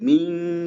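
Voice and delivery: MIN drawn out as a humming "ming"; held nasal. Shown as a demonstration. Teacher warning students to hold it, "min" as long ming. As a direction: stretch "min" on a hidden ng.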